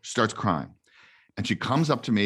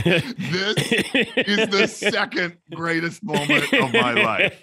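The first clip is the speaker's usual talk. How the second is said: high-pitched, laughing through the words; giggly mock-emotional declaration; laughing mock quote; 11 semitones higher, 4 dB louder, slower than usual. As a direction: deliver the punchline while cracking up. Joke callback to the bride's line.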